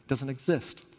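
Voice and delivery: ordinary talk, nothing marked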